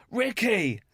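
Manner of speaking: high, drawn-out mock exclamation; imitated shocked cry. Imitating girlfriend catching him peeing, shocked. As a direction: cry the name out in mock horror.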